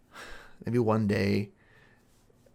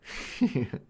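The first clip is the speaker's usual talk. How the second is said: short sinister laugh; a little dark laugh to yourself; creepy chuckle; 6 semitones higher than usual. Acted. Lover after a veiled threat.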